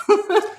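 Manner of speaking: a quick high burst of a laugh; a real amused laugh; short laugh. Real, not acted. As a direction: laugh at her own joke. Laughs after bookstore quote.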